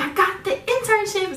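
high, loud re-enacted shout of joy; squealed good news, acted out; excited announcement. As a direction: share huge news with the room. Retelling her joyful announcement.

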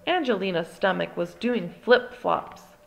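plain reading voice

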